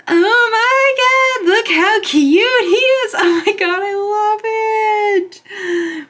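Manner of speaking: very high, drawn-out fangirl squeal; gasp, then squeal high in real delight; ecstatic squealing. Real, not acted. Fan pulls the photo card she loves, ecstatic squealing.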